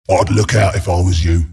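very low, ominous caution; low warning. Acted. Announcer warning a player of danger, low warning. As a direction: drop deep to warn them.

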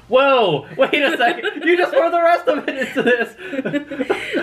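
startled laughing exclaim; shocked, high and laughing; surprised. Real, not acted.

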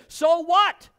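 high, loud and defiant; defiant triumph. Real, not acted. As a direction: toss it back with triumph. Defiant reply to doubters.

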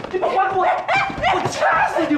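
very high, very slow drawn-out screech; mock threat yell. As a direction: stretch the threat out shrilly. Threatening during the quarrel.

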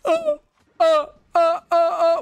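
short high screams of panic; panicked screams. Real, not acted. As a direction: scream as you get killed. Dying in the game.

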